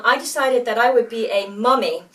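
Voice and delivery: ordinary talk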